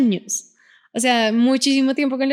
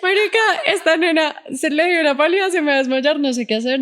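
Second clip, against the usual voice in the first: mock-panicked phone plea, higher at first, laughing; retold plea for help; 5 semitones higher, faster than usual. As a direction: call a friend in a half-laughing fluster. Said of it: "puedo llamar a un amigo". (llamada de auxilio, apurada y entre risas)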